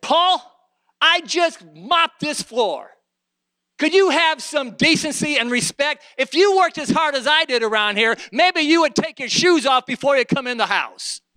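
loud, high acted telling-off; mad scolding. Wife scolding Brother Paul over mud in the house, angry. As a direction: play an exasperated wife laying into him. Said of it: "got mad at Brother Paul".

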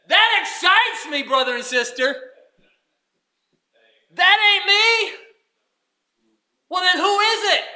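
booming surprised joy; excited exclamation. Real, not acted. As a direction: preach it with delighted amazement.

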